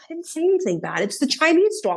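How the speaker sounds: high-pitched defensive mom voice; indignant protest. Retold or imitated. Mother defends herself to embarrassed daughter, indignant. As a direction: imitate a mother protesting her innocence.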